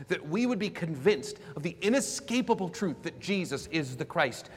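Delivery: impassioned sermon delivery; forceful preaching. Real, not acted. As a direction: press the point on the congregation, slowing at the end. Preaches main point with force.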